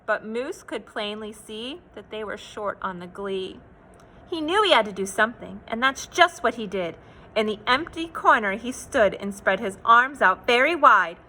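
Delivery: ordinary delivery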